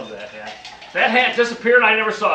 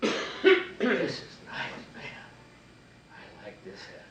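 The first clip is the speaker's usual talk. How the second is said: hushed admiration; 6 semitones higher, 4 dB quieter than usual. Retold or imitated. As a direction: breathe it like a secret treasure. Secretly admiring hat in shop.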